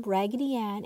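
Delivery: usual speaking voice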